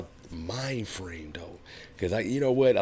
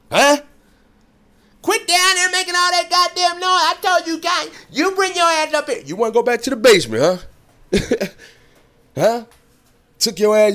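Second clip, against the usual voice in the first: put-on shrill high old lady hollering down the stairs, much louder; yelling grandma; 13 semitones higher, 7 dB louder than usual. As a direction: holler like a grandma fed up with the noise.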